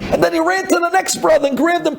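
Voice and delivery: ordinary delivery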